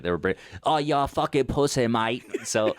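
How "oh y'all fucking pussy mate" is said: put-on light British accent, brash and taunting; mock British lad accent. Friends jeering each other in mock British accent. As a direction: toss off a cheeky British insult. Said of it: "they didn't say it like that".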